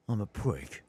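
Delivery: bad Cockney. Shown as a demonstration. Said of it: "you have to do a bad Cockney".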